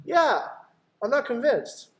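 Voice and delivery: high, pushed yeah as the client; mock client. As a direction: play the stubborn client. Role-play client answering.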